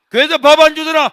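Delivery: high, full-blast mocking question; scolding mother. Mother scolding son home from church, angry shouting. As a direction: yell it at the hungry son. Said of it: "야단치니까".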